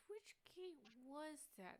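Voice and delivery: a hint of a simple watchman echoing; dim guard. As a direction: repeat it slow and baffled. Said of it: "He goes".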